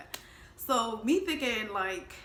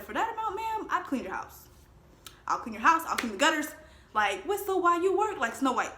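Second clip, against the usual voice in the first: eager; 6 semitones higher, 5 dB louder than usual. Retold or imitated. Mock eager servant.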